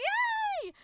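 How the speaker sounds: very high squealed yay; delighted yay. Retold or imitated. Fan squeals yay at the selfie offer. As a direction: squeal with joy. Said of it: "I'm like".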